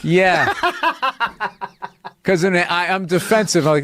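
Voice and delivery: usual speaking voice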